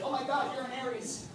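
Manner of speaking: put-on high, hushed girlish gush; imitated excited date. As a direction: imitate a date thrilled by star signs. Imitating his date discussing star signs.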